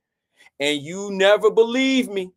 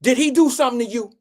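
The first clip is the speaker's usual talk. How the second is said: fast, higher accusing mom voice; mother accusing; 3 semitones higher, 4 dB louder, faster than usual. Retold or imitated. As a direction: play the protective mom grilling the girl. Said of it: "And she says".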